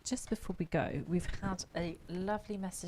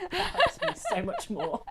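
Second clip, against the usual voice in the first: high, loud, helpless laughter through the words; squealing with laughter, much higher and louder; laughing; 8 dB louder than usual. Real, not acted. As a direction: crack up while talking. Cracks up at the helpful message.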